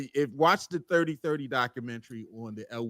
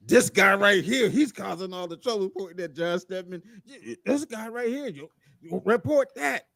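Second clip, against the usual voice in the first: higher, louder blustering owner; mock Irsay; 6 semitones higher, 7 dB louder than usual. A higher, louder Irsay blaming the reporter at the press conference. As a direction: point the finger and bluster. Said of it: "quoting Irsay pointing at reporter".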